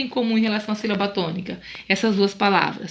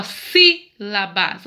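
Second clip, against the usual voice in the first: "sílabas" slowed, first syllable "sí" held and strong; teacher demo of "sílabas" with a strong opening syllable; stress on SI; 2 semitones lower, 3 dB louder, much slower than usual. Shown as a demonstration. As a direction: break it up as SÍ-la-bas, hitting "sí". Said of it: "ó, si".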